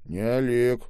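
usual speaking voice

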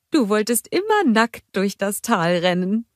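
cheerful motherly troll greeting; Bulda's voice. A narrator's reading. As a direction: welcome them home warmly. Bulda teasing.

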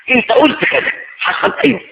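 high, pressing questioner; interrogation. Acted. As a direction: corner him with questions. Acted interrogation on judgment day.

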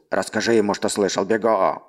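eerie warped distant voice; distorted voice. Acted. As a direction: voice bent and warped. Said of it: "услышал он чей-то сильно искажённый голос".

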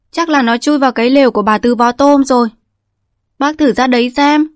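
put-on a young girl reciting a practiced lie; rattling off like a machine. A narrator's reading. Girl Dần misleads old Bành, rattling it off, high and loud. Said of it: "lại nói như máy".

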